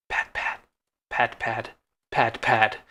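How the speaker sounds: whispered pat pat; quiet creeping footsteps; soft footstep sounds. Pretend. GM imitates approaching footsteps.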